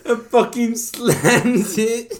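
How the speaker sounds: high, slow words pushed through laughter; real laughter in the voice; laughing while telling. Real, not acted. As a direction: fight the giggles.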